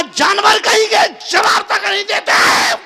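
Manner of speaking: high, loud angry yell; furious wife. Acted. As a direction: explode at the silent husband. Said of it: "जब उसका गुस्सा सिर के ऊपर चढ़ गया". (गुस्से में चिल्लाती पत्नी)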